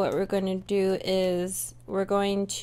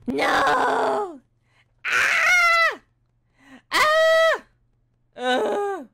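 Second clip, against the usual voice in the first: high piercing game screams and shouts; panicked gamer screaming run away and dodge; test screams; 9 semitones higher, 7 dB louder, slower than usual. Shown as a demonstration. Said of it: "So we're gonna be like, ... test out our screams".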